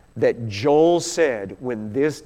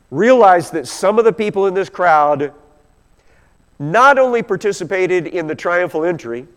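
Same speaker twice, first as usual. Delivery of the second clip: normal talking voice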